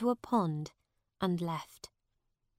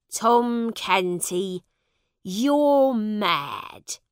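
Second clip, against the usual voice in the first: stern slow father; 7 dB louder, much slower than usual. Acted. Father dismisses the boy as mad.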